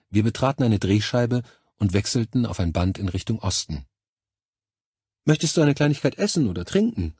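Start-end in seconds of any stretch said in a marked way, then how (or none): 5.2-7.1 higher-pitched innocent question; feigned innocence. A narrator's reading. Woman offers her lover a snack, playing innocent. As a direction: ask it sweetly innocent, pitched up. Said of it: "mit einem unschuldigen Augenaufschlag".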